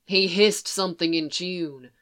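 plain reading voice

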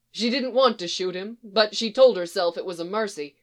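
ordinary delivery

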